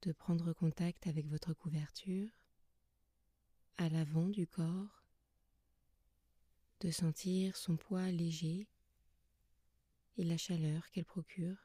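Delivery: soft, calm yoga nidra guidance with long pauses; guided meditation. Acted. Yoga teacher settling listeners lying under blankets, soft guided meditation. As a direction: guide them gently into rest, leaving long silences between phrases.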